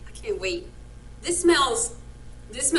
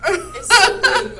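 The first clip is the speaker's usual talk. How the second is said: savoring delight; 8 semitones higher, 8 dB louder, much slower than usual. Real, not acted. Savoring food, drawn out.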